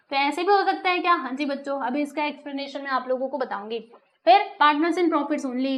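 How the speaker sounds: ordinary delivery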